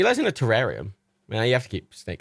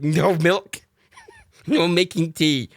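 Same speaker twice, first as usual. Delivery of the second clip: mock stern refusal; 9 semitones higher, 5 dB louder, much slower than usual. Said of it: "And I'm like".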